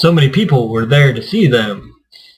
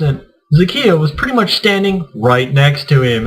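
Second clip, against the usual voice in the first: normal talking voice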